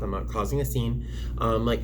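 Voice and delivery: ordinary talk, nothing marked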